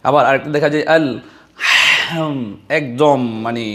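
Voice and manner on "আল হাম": আল হাম with a hard, forced ha. Shown as a demonstration. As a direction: show the wrong hard ha in হাম. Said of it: "খুব শক্ত করে উচ্চারণ করছে". (খুব শক্ত করে হা উচ্চারণ)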